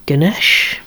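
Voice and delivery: usual speaking voice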